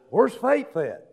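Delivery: ordinary talk, nothing marked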